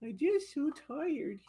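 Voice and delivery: high, soft tired puppy voice; whiny sleepy pup; voicing the dog. Owner voicing the tired poodle to viewers, high and soft. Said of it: "He said".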